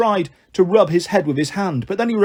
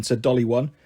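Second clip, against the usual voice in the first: ordinary delivery, nothing marked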